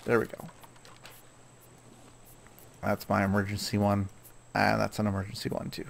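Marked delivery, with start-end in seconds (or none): none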